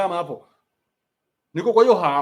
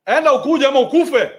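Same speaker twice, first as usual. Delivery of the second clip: higher, louder barked order; harsh dad order; 7 semitones higher, 7 dB louder than usual. Retold or imitated. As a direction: shout it like a tough dad. Quoting harsh dad joke.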